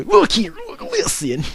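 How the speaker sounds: amused mock impression; Wings of Redemption. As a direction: imitate him high, then drop. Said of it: "That's funny".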